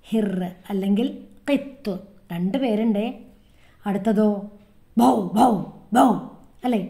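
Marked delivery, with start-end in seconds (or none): 5.0-6.1 louder barks, bow bow; dog barking. Shown as a demonstration. Teacher showing children how a dog barks, loud woofs. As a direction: bark like a dog. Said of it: "അങ്ങനെ നായ കുരയ്ക്കും".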